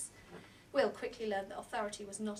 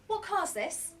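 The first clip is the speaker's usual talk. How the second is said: eager question; 9 semitones higher, 4 dB louder, slower than usual. Acted. Boy calling to the driver.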